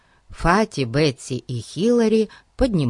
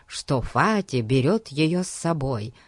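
ordinary delivery, nothing marked

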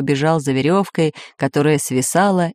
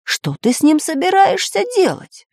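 high, rising alarm; aghast question; 13 semitones higher than usual. A narrator's reading. Geographer questions spy about the egg, aghast. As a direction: demand it in disbelief, pitch shooting up. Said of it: "схватился за голову географ".

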